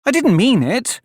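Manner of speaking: high-pitched, earnest plea; pleading. A narrator's reading. Alice begs the offended mouse, pleading. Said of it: "pleaded poor Alice".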